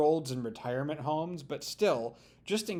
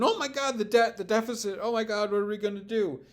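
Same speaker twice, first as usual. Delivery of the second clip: high, quick mock alarm; hammed-up hand-wringing over the debt; mock deficit panic; 6 semitones higher, faster than usual. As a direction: parody a fretting conservative. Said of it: "but now all of a sudden".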